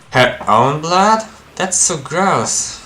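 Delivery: slow, high, rising grossed-out reaction; disgusted disbelief. Acted. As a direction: stretch it out in squeamish disbelief.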